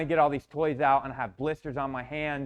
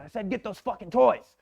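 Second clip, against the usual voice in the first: put-on loud, higher furious yell at a child; angry yell; 7 semitones higher, 5 dB louder than usual. Abusive dad yelling at son over boiling water, loud angry yell. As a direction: bark the order at the kid. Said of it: "he yells at me again".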